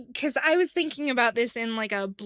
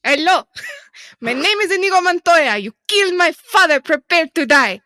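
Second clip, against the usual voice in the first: put-on loud, higher Spanish accent; 6 semitones higher, 12 dB louder than usual. Host's cohost shouting Inigo Montoya's lines in a Spanish accent. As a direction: declaim it like a swordsman in a Spanish accent. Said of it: "I'm gonna do an accent".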